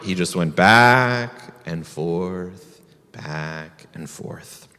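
slowed down, dropping low and soft; slow swaying narration. A narrator's reading. Narrator slows as rabbit swings. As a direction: let it swing and fade, back and forth.

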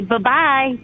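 ordinary talk

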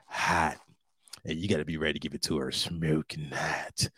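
voice dropping low. Real, not acted. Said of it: "I don't know why my voice gotta do that".

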